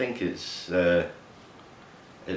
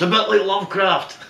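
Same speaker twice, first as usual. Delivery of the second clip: mock shout from a car; 10 semitones higher, 4 dB louder than usual.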